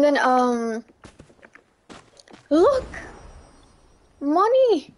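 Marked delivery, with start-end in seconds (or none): 2.5-4.9 high excited call; excited shout. Real, not acted. Streamer spotting money, calling it out high and excited. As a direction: point it out with delight. Spots coins in game.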